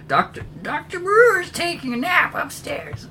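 put-on very high, thin old lady voice; high old woman voice. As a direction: squeak it out like a frail old woman. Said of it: "kind of moves away and says".

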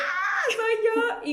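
high, drawn-out startled gasp; acted sudden realization. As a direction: exclaim it slowly in mock shock. Acts out realizing it was her.

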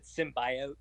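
"symbiote" with a long "bye" in the middle; imitated mispronunciation. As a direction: say "symbiote" as sim-BYE-oat. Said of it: "had no idea how to say symbiote".

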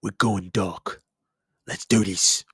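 low, slow gruff British soldier; Captain Price impression. Gamer doing his Call of Duty captain, low and slow. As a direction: growl it like a grizzled commando. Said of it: "And he sounds like this".

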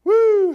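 joyful high woo shout; high whoop. Real, not acted. Exclamation after climax.

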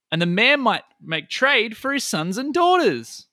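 high, swooping, declaimed rhymes; battle poem. Acted. Gun battles Heidel before a stadium crowd, high declaimed poem.

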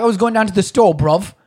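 high, loud British lad impression; lively put-on British slang accent. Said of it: "When British people say".

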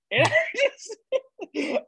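high, slow words dissolving into laughter; helpless laughter. Real, not acted. Host cracking up at his own Irish impression, laughing helplessly. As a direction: laugh too hard to get the words out.

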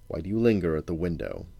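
usual speaking voice

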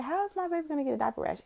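high, fast, exasperated imitation; indignant question. Mother demanding answers about diaper rash, indignant and high. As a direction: fire it off high and fast in disbelief. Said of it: "So I was like".

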